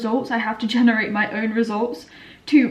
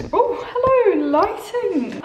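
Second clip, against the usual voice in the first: surprised greeting; 10 semitones higher, 3 dB louder, much slower than usual. Real, not acted. Greeting the sudden sunlight.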